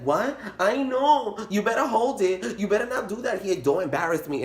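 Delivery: high hissed scolding, a mock stern mother; a hint of a stern mom hushing her kid. Mom warning her kid not to embarrass her, mock stern scolding.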